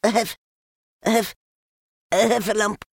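high, panting, broken attempts at the word; out of breath. A narrator's reading. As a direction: squeak it out between gasps. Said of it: "breathing so hard that he could hardly speak".

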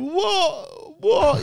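very high, near-crying wail of confusion; panicked Mike. Retold or imitated. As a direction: yelp it out high and scared.

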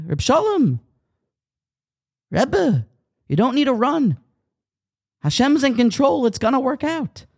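high-pitched grandmotherly call of reassurance; calm bubby calling out. Retold or imitated. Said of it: "And in her calm way, she spoke out to us".

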